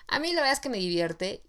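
ordinary talk, nothing marked

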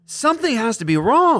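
imitating worried disbelief, pitched high; high-voiced retelling of nervous doubt; anxious disbelief. Retelling being on pins and needles, imitating anxious disbelief.

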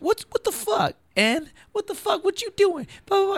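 mock-furious high voice on the phone; Punky Johnson on the phone. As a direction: squeal it way up high like an irate caller. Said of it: "Punky picks up the phone".

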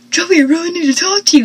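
hammed-up frantic yell, rushed and loud; mock screaming. Dawson rushing in to Joey, mock screaming. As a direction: blurt it out fast like a panicked mock scream.

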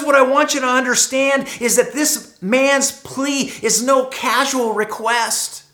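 normal talking voice

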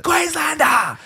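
loud, high, stretched-out yell; full-throated high chant, long last syllable; yelled war cry. Acted. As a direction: holler the name as a battle cry. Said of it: "shakes it and yells".

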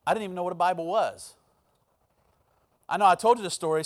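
ordinary talk

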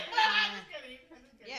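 laughing through the words. Real, not acted. Laughs off divorce joke.

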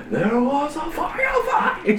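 high, slow, dramatic; classic line delivery. Retold or imitated. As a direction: proclaim it dramatically, slowly. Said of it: "he's got the classic line".

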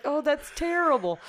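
high-pitched retold dismay; sad sympathy. Dad hearing his daughter's news, sad imitation. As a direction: imitate dad feeling sorry for her. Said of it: "he's all sad for me".

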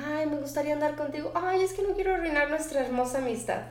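quick, soft, sugary mock of a girl letting him down; mock friend-zoning girl. A friend turning down a suitor, lightly mocked. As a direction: breeze through it like a sweet brush-off. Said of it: "que son amigos y te dice".

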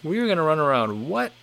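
surprised reaction. Retold or imitated. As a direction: replay a mild double take. Startled reaction to misreading.

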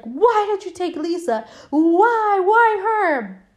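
high, loud mock-anguished wail; anguished demand. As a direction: cry out in hammed-up desperation. Character confronts the doll about Lisa.

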